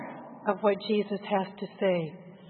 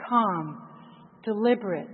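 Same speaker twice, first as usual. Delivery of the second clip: usual speaking voice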